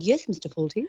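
put-on crisp, polite posh English accent. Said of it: "posh English accent".

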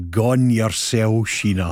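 slow, drawn-out fake Glasgow patter; fake Glasgow accent. Guest retelling a cringey remark to Sheena, put-on Glasgow accent. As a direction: stretch each word in put-on Glaswegian. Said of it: "This is this fake Glasgow comment".

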